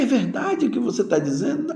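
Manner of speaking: high, quick amazed question; incredulous joy. Acted. Debtor told the debt is forgiven, asking in high amazed disbelief. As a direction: act out disbelief at good news. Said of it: "É assim que nós ficamos".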